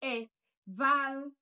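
"Evaluate" slowly, syllable by syllable; broken into syllables. Shown as a demonstration. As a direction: break "Evaluate" into e-VAL-u-ate with gaps. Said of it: "let's break it down".

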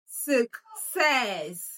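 "success" drawn out as suk... SESS; stress on second syllable. Shown as a demonstration. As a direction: lead a class repeat of "success", long SESS. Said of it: "let's pronounce it together".

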